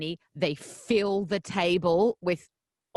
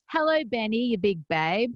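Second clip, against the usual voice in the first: bright American radio greeting, pitched up on hello; American accent; 4 semitones higher, 3 dB louder than usual. Pretend. Host greeting Benny in a put-on American accent. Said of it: "You sounded, uh, American".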